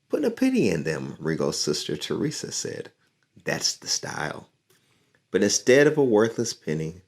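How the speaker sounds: lively storybook reading; reading to children. A narrator's reading. Teacher reading a picture book to her class, lively. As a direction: read the story aloud to young listeners.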